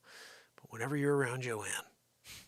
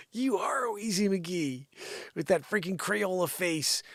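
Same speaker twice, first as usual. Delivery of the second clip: taunting retort; 4 semitones higher than usual. Pretend. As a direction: draw out the taunt. Answers the mock voice as himself.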